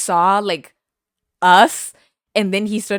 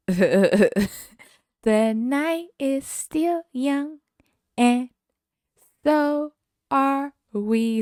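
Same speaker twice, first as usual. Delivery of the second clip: drawn out, higher, movie-trailer voice; slow dramatic line; 8 semitones higher, much slower than usual. Retold or imitated. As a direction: savor each word like a grand line.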